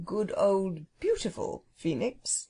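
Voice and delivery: slow, shy phoenix, drawing the words out; coy, lingering little correction. A narrator's reading. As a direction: gently correct them, bashful and unhurried. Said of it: "it corrected shyly".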